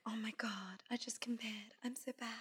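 hushed self-scolding whisper; guilty whisper. Retold or imitated. Scolding herself for comparing bodies, in a whisper. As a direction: berate yourself under your breath. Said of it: "I'd be like".

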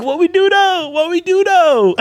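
high, slowing mock panic; panicked mock plea. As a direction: squeak it out scared, drawn out. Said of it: "I was just like".

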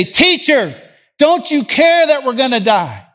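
high, loud panicked plea; scared apostles. Acted. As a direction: cry out in alarm over the storm. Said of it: "Have you ever been so scared".

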